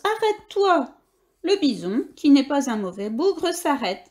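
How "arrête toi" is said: high pleading cry at the end of his strength; exhausted shouting plea. Acted. Hamster on a galloping bison begs it to stop, crying out. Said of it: "crie Billy à bout de force".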